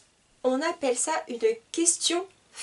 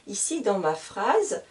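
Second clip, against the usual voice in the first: usual speaking voice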